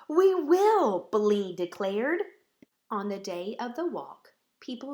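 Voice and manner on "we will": high, loud ringing promise; bright eager reply, much higher and louder; eager cry. Acted. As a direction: call it out proudly. Bellini answers, eager.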